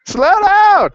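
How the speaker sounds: high, loud demo shout of slow down; yell. Shown as a demonstration. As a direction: yell it out like a student begging the teacher. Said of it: "I need you to yell something".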